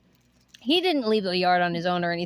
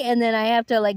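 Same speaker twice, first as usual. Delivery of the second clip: ordinary talk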